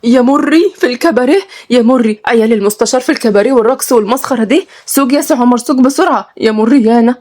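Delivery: put-on woman horrified to find the boys at a nightclub; scandalized shock. A narrator's reading. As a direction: clutch your pearls and cry out. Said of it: "وسلمى اتصدمت".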